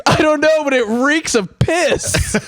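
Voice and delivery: high, slowed deadpan from the buddy; lightly voiced laughing friend; mock buddy. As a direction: play the friend blurting it out. Buddy answers the mechanic.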